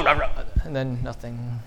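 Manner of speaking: normal talking voice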